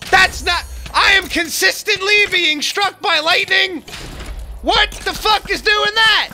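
high, loud, exasperated yelling; furious shout. Real, not acted. Gamer hit by lightning again and again, raging loudly.

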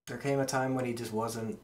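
ordinary delivery

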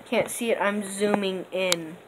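ordinary delivery

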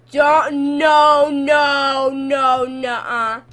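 loud, drawn-out repeated cries; loud fearful wail, easing off; panicked refusal. Real, not acted. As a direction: shout each word in protest, stretched. Refusing in fear.

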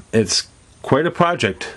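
normal talking voice

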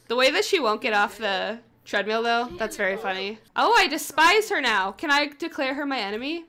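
ordinary delivery

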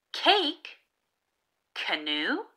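ordinary delivery

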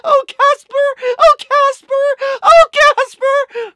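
high, slow, drawn-out wail of the name; mock wailing lament. YouTuber mourning the troll's bad joke, high drawn-out wail.

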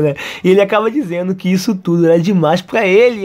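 plain reading voice

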